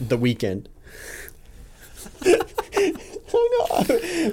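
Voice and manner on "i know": high laughing giggle. Real, not acted. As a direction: giggle through the words. Laughing while talking.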